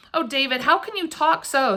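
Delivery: high, mildly distressed plea; a touch of tearful reproach; sobbed reproach. A narrator's reading. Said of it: "sobbed her mother".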